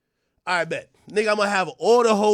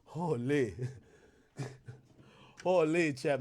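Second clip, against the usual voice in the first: ordinary talk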